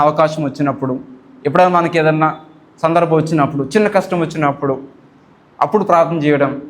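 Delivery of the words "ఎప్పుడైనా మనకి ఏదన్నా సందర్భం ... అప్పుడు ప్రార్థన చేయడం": ordinary delivery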